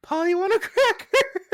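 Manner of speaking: high, loud put-on voice; mock the character, squeaky and loud. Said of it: "then he goes".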